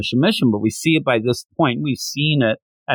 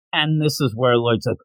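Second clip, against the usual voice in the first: usual speaking voice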